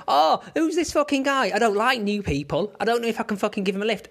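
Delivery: high fast mock meltdown; mocking Shaky. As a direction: squeal it out, mocking the panicking mate. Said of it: "What's he gonna do?".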